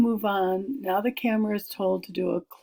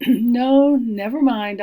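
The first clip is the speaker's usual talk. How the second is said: a louder, higher no, never mind; Hildy declining to be announced, louder; firm refusal; 4 semitones higher, 9 dB louder, slower than usual. Acted. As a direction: wave off the offer with a confident push. Hildy brushes off being announced.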